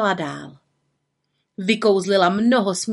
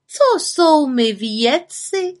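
feigned surprise; 7 semitones higher, 4 dB louder, much slower than usual. Acted. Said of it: "jako by se divila".

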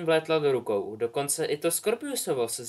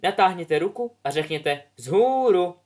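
higher, louder instructing voice; brisk teacher command; 6 semitones higher, 7 dB louder than usual. Acted. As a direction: drill the class.

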